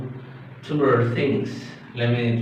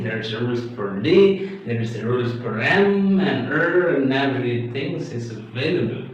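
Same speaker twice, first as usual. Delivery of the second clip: ordinary delivery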